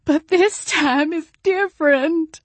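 very high, slow, loud wail. A narrator's reading. Jolene begging Jane for help, slow high tearful wail. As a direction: plead through tears, drawn out. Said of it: "Jolene wailed".

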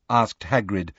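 usual speaking voice

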